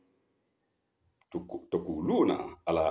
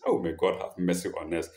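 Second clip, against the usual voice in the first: ordinary delivery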